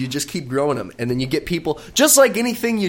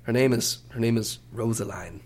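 hushed mock channeling; 5 semitones lower, 6 dB quieter than usual.

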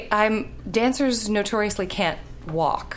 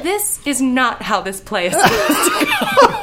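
laughing; 7 semitones higher, 5 dB louder than usual. Retold or imitated. Laughing at own stage fall.

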